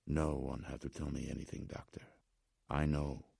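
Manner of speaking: put-on hushed old man, Slavic accent. A narrator's reading. Old man confiding in the doctor, hushed Slavic accent. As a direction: answer very softly in a Slavic accent. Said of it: "The man spoke with an accent".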